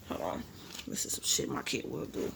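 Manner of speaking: hushed muttered aside off the reading; whispering aside to self; whispered aside. Real, not acted. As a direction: drop to a whisper to comment.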